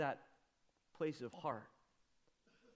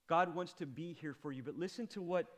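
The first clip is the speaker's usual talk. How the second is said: normal talking voice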